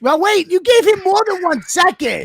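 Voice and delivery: high-pitched, heated objection; indignant protest. Real, not acted. Contestant disputing the one-second limit, indignant high protest. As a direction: object with the voice jumping up.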